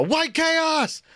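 very high-pitched exclamation; random mock outburst. Imitating a friend's random catchphrase, high outburst. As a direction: blurt it out in a high mock cry. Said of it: "my friend used to just randomly go".

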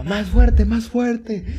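put-on child shouting at the carousel pusher, high yell; kid yelling. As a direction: shout it like a thrilled kid on a ride. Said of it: "el que me gritaba".

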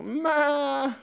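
high bleating mother goat; a high, mournful goat meh. Retold or imitated. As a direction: bleat like a crying nanny goat. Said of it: "She'd go".

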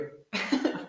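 short airy chuckle; quick breathy laugh. Real, not acted. Laughs at joke.

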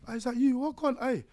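high, surprised greeting; excited youth greeting. Retold or imitated. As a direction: call out to a friend. Inmate greets narrator on bus.

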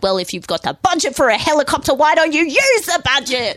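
high, mock-indignant reply; hammed-up snippy comeback; snippy retort. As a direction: voice a touchy company answering back. Voicing company's snippy review reply.

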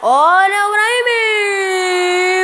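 long drawn-out rising call; explosive goal commentary. Real, not acted. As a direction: build up the call, stretched slow. Penalty kick about to be taken.

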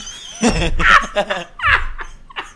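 squealing high laugh. Real, not acted. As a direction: laugh up in falsetto. Laughing at the scene.

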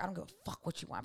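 put-on low, soft-spoken dominating boyfriend; curt dominating man. Boyfriend overruling his girlfriend on dinner, low and curt. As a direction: say it low and quiet, laying down the law. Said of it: "He gotta say it just like that?".

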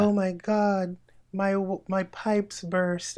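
high, drawn-out mock damsel in distress; slow, high-pitched hammy melodrama; cheesy porn acting. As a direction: ham it up like a bad film actress. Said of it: "people aren't into the cheesy acting".

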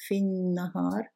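في النهار as fin-nahar, n held long; straight to nun. Shown as a demonstration. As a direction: go from في directly into a double n in النهار. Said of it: "straight from fi to the nun". (في النهار with no al sounded)

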